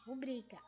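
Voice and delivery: rubrica stressed on BRI; correct stress. Shown as a demonstration. Teacher correcting the habit, rubrica with stress on BRI. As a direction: lean on the middle syllable of rubrica. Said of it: "O correto é".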